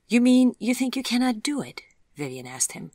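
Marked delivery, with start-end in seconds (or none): 0.1-1.8 put-on higher, teasing young woman; Vivian's voice. A narrator's reading. Vivian teasing Chip that he cannot wash the dishes. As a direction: pitch it up as a cheeky dare.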